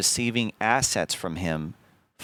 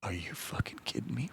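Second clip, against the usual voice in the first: breathless, seething whisper; furious whisper; 13 dB quieter than usual. Retold or imitated. Seething whisper at the news his partner is just chilling. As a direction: hiss it out in disbelief. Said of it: "And I was like".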